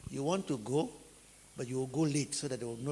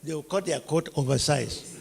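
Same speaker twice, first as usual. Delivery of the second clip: ordinary talk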